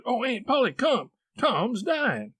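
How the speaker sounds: put-on high, urgent boy's call; frantic high-pitched news; panicked cry for help. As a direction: summon your aunt in alarm. Sid runs for help.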